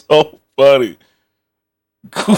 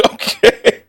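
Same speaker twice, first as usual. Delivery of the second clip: Okay. breathed out through a laugh; a real giggle over Okay; Okay. half-laughed and unvoiced. Real, not acted.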